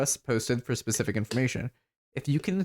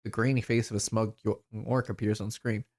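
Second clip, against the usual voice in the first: normal talking voice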